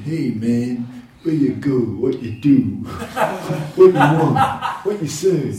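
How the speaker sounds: a loud, laughing mock of clipped street talk; street English. Mocking street English to the devotees, loud and laughing. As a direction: rattle off one-syllable slang with a grin. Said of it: "the street English".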